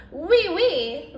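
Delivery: "Oui, oui" said the French way, high and chirpy; an eager French "Oui, oui"; French yes. Acted. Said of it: "that's French for".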